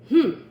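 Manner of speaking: loud, high snorting hmm. Acted. Gardener snorting at the bunnies, loud. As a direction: snort it out in irritation. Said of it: "he snorted".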